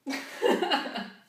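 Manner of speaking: laughing out loud, high and bright; real burst of laughter. Real, not acted. Reader laughing after finishing the steamy scene.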